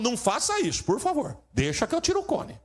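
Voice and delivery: rising high pleading voice; eager usher pleading, pitched high; insistent usher. Retold or imitated.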